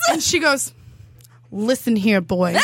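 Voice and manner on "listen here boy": stern sassy woman, low voice; sassy scolding. Retold or imitated. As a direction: scold him like a sassy auntie.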